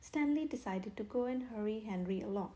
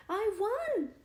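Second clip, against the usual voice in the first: much higher, loud joyful shout; triumphant burst; happy cry; 10 semitones higher, 7 dB louder than usual. A narrator's reading. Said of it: "he cried happily".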